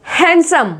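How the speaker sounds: हैंडसम as "hen-sum"; silent d demo. Shown as a demonstration. Teacher modelling handsome, silent d. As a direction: skip the d in हैंडसम. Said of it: "लिखा है लेकिन".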